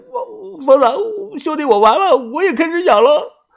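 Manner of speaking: put-on a young man sobbing that he's doomed; high, crying voice full of dread; crying in panic. A narrator's reading. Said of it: "边挠边哭着说".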